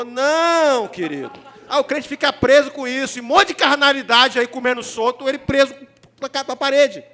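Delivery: loud, driving sermon delivery; fiery preaching. Acted. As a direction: preach it hard to the congregation. Preaching at full force.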